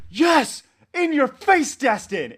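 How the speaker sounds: loud, high-pitched triumphant shout; mock victory scream; imitating gloating freak-out. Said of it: "and freaking out".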